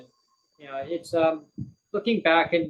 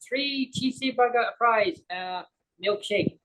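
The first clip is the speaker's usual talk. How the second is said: high slow mock accent, cheesy burger; slow, pitched-up order in her accented English; slow imitation accent naming three cheesy burger fries; imitating cafe lady accent; 7 semitones higher, slower than usual. Kid mimics the cafe lady ordering.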